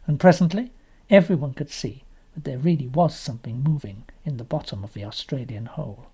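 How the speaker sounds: plain reading voice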